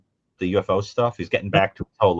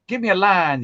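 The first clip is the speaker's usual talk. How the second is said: higher voice, lightly imitated drawling accent; Piper Laurie; 8 semitones higher than usual. Actress asked to sign, imitated accent. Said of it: "she had that great accent".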